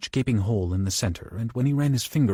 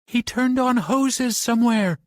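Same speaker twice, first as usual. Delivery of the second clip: much higher, louder and slow panicked shriek; high squeaky shriek; 14 semitones higher, 4 dB louder, slower than usual. Acted. Trapped Bobby shrieks to Johnny about the hoses, high squeaky fear. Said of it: "in a high, squeaky shriek".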